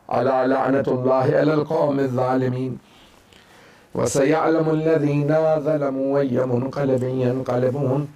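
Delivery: low chanted prayer to end the majlis; closing prayer. Acted. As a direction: settle into the closing dua. Closing dua after the lament.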